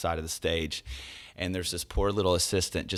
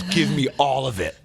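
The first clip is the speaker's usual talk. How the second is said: louder, higher, insistent pastor; excited urging; 6 semitones higher, 6 dB louder than usual. Retold or imitated. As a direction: egg the singer on loudly. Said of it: "He goes".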